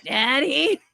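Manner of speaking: raspy smoker voice, high and loud; smoker's voice. Retold or imitated. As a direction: rasp it like a heavy smoker. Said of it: "She got the smoker's voice".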